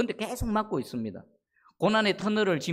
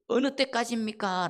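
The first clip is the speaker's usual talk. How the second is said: higher, sustained lament; 4 semitones higher than usual. Acted. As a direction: draw the question out as a plea to God. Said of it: "라고 하나님을 향해 탄식하는".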